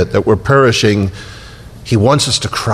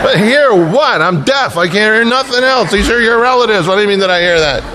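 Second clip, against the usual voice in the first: exasperated husband; 9 semitones higher than usual. Retold or imitated. Husband exasperated over loud relatives.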